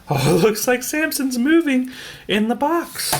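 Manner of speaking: high, amused laugh into the words; laughing delight. Real, not acted. Reader to listeners, laughing at the moving box. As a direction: chuckle with delight at the sight.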